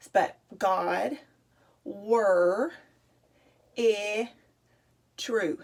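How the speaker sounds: God's word is truth read with final sounds dropped: God were it true; words read with their endings clipped off; missing final sounds. Shown as a demonstration. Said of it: "read it without the final sound".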